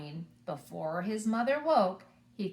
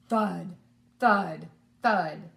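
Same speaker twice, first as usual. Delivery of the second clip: loud, falling thud on each; heavy thuds; 7 dB louder, much slower than usual. Shown as a demonstration. Reader leading children in giant footsteps, loud. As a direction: stomp each word like a footstep. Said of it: "Can you say it with me?".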